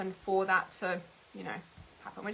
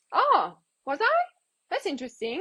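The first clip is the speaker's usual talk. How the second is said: mock-innocent surprise, pitched up; playing along; 7 semitones higher, 3 dB louder than usual. Toying with a scam caller, mock-innocent. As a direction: feign interest to toy with them. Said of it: "I wanna say, playing with them".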